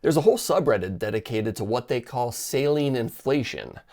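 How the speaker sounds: ordinary talk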